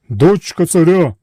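loud slow genie chorus; shouted in unison. A narrator's reading. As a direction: boom in chorus. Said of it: "крикнули вони в один голос".

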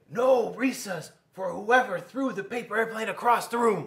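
high, loud stern teacher voice; strict teacher. Acted. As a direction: lay down the punishment sternly. Teacher scolding class.

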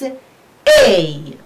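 A drawn out as "eyy"; sustained, falling A; diphthong demo. Shown as a demonstration. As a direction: model A as one long glide from e to i. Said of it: "We pronounce it as".

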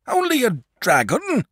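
alarmed father crying out; cried out. Acted. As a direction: throw it back at the boy in alarm. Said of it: "cried his father".

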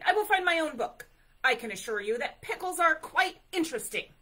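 fast, high, loud bear outburst; shouting. A narrator's reading. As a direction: rattle it off in an indignant shout. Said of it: "Bear shouted".

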